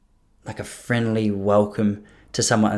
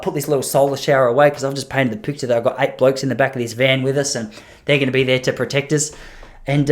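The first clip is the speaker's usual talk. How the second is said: normal talking voice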